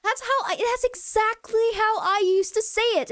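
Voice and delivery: high-pitched, thrilled exclamation; excited outburst, pitched well up. Retold or imitated. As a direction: blurt it out in delighted recognition. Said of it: "and I was very excited".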